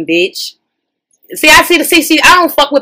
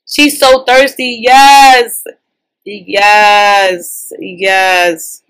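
louder, slow and drawn out; loud hype; 6 dB louder, much slower than usual. Real, not acted. Reacting to the couple, a loud drawn-out cheer. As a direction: holler it out with glee.

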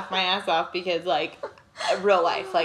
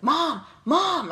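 imitating a child calling; 7 semitones higher than usual. Son calling his mother at night, alarmed shout. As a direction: call out for mom in alarm. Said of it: "he's like".

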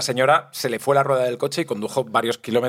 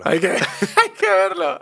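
laughing mimicry, pitched way up; mocking laugh; 3 dB louder, slower than usual. Echoing his co-host's words to tease him, laughing high and slow. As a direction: echo the phrase high and slow through laughter.

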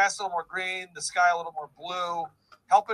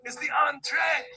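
mock shout; 8 semitones higher than usual. Mocking shout of entree.

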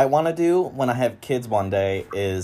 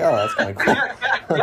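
laughing; 7 semitones higher than usual. Retold or imitated. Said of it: "And I was like".

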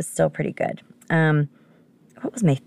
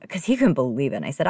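usual speaking voice

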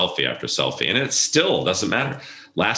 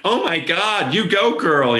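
high, loud mock squeal of Oh my God; flamboyant screaming; 12 semitones higher, 3 dB louder, slower than usual. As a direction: camp it up in a shrill mock scream. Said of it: "screaming like".

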